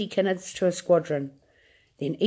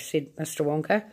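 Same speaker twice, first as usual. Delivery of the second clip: usual speaking voice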